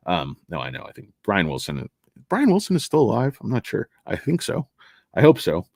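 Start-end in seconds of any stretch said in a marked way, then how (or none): none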